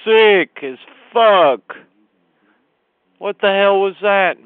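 a real fan roaring his anger; furious yell. Real, not acted. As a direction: bellow it out in disgust at the team. Furious fan yelling about the loss.

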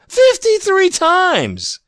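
Fifty-three times! yelled high, loud and slow; outraged shout. Real, not acted. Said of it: "I'm sorry I got emotional".

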